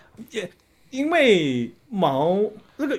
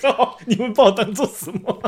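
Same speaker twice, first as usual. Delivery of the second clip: loud, higher outburst through laughter; laughing protest; 4 semitones higher, 4 dB louder than usual. Real, not acted. Teased co-host objects to the joke about them, laughing protest. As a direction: protest indignantly while cracking up.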